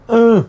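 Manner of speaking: "U" from the throat and through the nose; nasal guttural "U" demonstrated; nasal guttural vowel. Shown as a demonstration. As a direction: add nasal color to the throaty "U". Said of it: "Tiene la virgulilla también".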